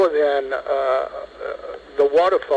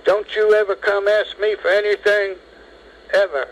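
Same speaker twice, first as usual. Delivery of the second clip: higher, raised mock shout at the narrator; retold shout; 8 semitones higher than usual. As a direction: retell the colonel's shout, pitched up high. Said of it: "he shouts at me".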